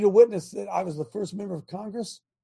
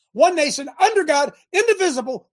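heated and higher, louder; getting angry; 6 semitones higher, 5 dB louder than usual. Real, not acted. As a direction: hammer the words home in anger. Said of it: "I'm sorry if I'm getting angry".

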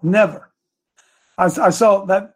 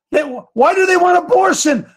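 heated shout; 10 semitones higher than usual. Real, not acted. Host raging at the left, shouting. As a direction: yell the question at them. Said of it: "Bo, I'm hot today".

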